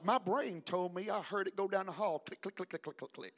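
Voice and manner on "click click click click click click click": imitated clicking. Imitating earbuds rolling down hall.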